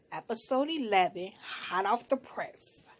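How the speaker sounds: ordinary talk, nothing marked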